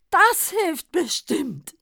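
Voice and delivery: high, loud, slow beaming Santa; glowing with confidence. A narrator's reading. Santa to the druggist, beaming and high. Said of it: "Der Weihnachtsmann strahlte".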